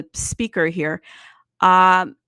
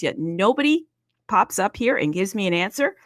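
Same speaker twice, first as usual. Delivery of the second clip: usual speaking voice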